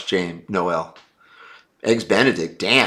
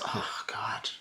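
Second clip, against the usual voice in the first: exasperated whisper; 16 dB quieter than usual. Real, not acted. Reacting to a viewer question.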